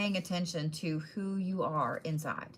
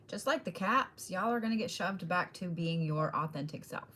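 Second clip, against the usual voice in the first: ordinary talk, nothing marked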